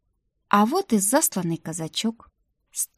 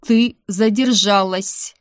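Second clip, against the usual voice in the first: stern madam; 4 dB louder, slower than usual. Acted. Strict madam scolding the maid.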